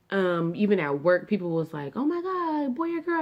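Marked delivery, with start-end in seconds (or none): 2.0-3.2 high squealy question; coworkers gushing. Retold or imitated. Coworker at work asking about the baby, high and gushing. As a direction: gush like an excited coworker.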